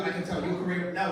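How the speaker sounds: ordinary talk, nothing marked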